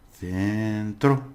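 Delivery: "Centro" with a long first syllable; stressed syllable. Shown as a demonstration. Teacher shows learners where stress falls, syllable drawn out. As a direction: stretch "Cen" in "Centro". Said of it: "the pronunciation is stress, where you do it".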